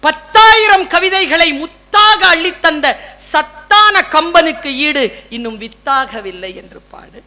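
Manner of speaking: slow, loud declaimed couplet; poem recital. Acted. Speaker praises Kamban to the audience in a slow declaimed verse. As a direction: chant it out slowly, in praise.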